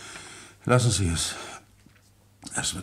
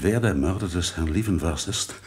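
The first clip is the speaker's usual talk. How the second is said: ordinary delivery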